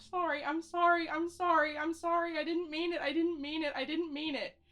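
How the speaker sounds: high, loud, desperate repeated apologies; shrill begging. Acted. Character curled in a ball begs the voices to stop, shrill and high.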